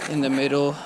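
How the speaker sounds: ordinary talk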